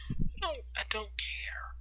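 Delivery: whispered, hugging her knees. Acted. Hiding girl to Ryder, whispered. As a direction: whisper it so no one hears. Sulking girl in dark closet.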